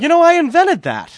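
Bobby Valentine voice. Retold or imitated. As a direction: brag like the inventor of everything. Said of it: "that's Bobby V".

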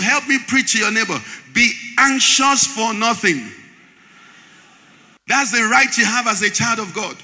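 loud, rising preacher's cadence; preaching. Acted. Pastor preaching to his congregation, fervent and loud. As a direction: drive the point home to the church.

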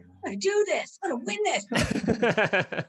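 high, loud, stretched-out holler; mock triumphant shout; excited runner impression. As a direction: imitate an elated runner yelling down the phone. Imitating runner's excited phone call.

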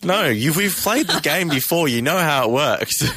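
high, slow, drawn-out protest; exasperated. Real, not acted. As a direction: explain it slowly, with mock exasperation. Exasperated host explains the game.

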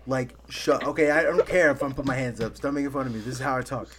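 normal talking voice

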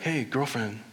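lightly voiced sassy friend; sassy girlfriend pep talk. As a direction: hype up your girlfriend. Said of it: "saying, like".

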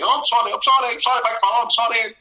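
flustered apology. Retold or imitated. Murray's flustered apology, acted out.

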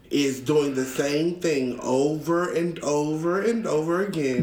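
drawn-out, very slow restatement; slow, deliberate. Real, not acted. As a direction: stretch every word out for emphasis. Restates definition slowly for effect.

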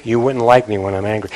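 low, soft mock menace; mock warning. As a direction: drop low and quiet for a playful threat. Joking quoted warning about anger.

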